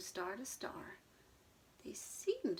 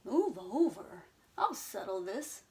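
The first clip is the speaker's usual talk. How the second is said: put-on bossy old horse; 6 dB louder than usual. Mister Brown pushing in to settle it, bossy. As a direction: take charge, no nonsense.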